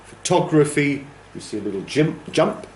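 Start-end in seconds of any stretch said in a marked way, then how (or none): 0.1-1.1 photography, higher, punched on its second syllable; second-syllable stress. Shown as a demonstration. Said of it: "from first syllable to second syllable".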